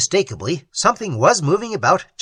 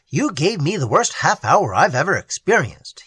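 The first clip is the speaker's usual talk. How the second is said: ordinary delivery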